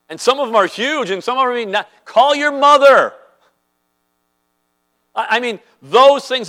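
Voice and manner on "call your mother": pointed exhortation. Real, not acted.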